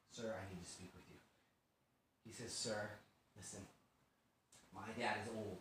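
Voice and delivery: hushed, low, respectful appeal; quiet acted entreaty; humble petition. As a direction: approach softly and humbly. Judah humbly petitions the ruler.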